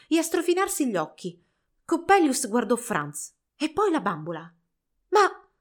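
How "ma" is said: "Ma" acuto e forte, di sorpresa; incredulo. Acted. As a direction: resta a bocca aperta. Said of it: "cominciò incredulo". (Coppelius vede Franz svegliarsi, "Ma" incredulo)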